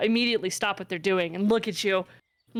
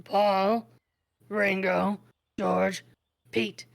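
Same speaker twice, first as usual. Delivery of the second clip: repeating in goblin; much slower than usual. Acted. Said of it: "he repeats it in Goblin".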